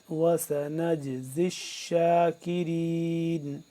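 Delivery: slow, drawn-out tajweed chanting; measured Quranic recitation. Shown as a demonstration. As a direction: recite each word with long held vowels. Teacher recites Quran verse.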